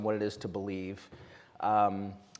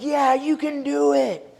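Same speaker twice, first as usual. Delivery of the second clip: high, slow crowd cheer; mock crowd yelling yes; 13 semitones higher, 5 dB louder, slower than usual. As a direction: call it back like an eager crowd. Crowd answering the tightrope walker.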